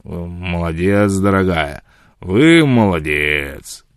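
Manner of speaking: put-on very slow, slurred drunk praise; tipsy, senseless drawl; drunken repeating. A narrator's reading. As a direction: draw it out like a man far gone in drink. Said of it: "икая и бессмысленно повторяя".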